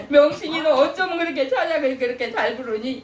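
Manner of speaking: high, loud laughing praise; God delighted. Retold or imitated. God praising the singer's voice, loud and laughing. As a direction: beam with joy at her singing. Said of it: "이렇게 하면은".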